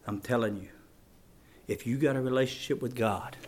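very low, soft, solemn appeal; quiet pulpit intensity with long pauses; hushed preaching. Real, not acted. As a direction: drop right down and question them gravely.

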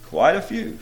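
ordinary talk, nothing marked

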